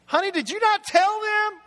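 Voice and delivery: hammed-up 'can you believe this' to his wife; complaining to his wife that a guest took his chair, mock-indignant; exasperated call to wife. Acting out annoyance at guest in chair.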